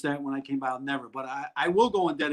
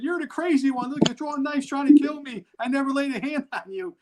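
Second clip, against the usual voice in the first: high-pitched, half-laughing defence; mock indignant protest; 10 semitones higher, 4 dB louder than usual. To his wife after she threw knives, high mock indignation. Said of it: "I said".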